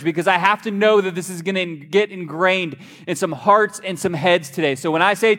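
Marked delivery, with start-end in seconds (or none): none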